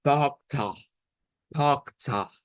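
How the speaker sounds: "pocta" with open O and final uh; Brazilian Portuguese pronunciation. Shown as a demonstration. Teacher sounding out the Portuguese word, Brazilian pronunciation. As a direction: say "pocta" slowly, r as a throaty h, central final a. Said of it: "esta palabra suena como".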